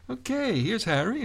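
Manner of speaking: normal talking voice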